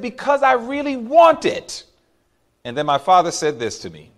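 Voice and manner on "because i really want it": put-on higher, pleading kid voice; whiny child insistence. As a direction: beg like a little boy who wants the toy.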